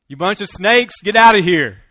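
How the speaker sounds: loud, furious yell with get out of here biggest; angry shout. Retold or imitated. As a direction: chase the Pharisees off, shouting. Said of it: "And what does John say?".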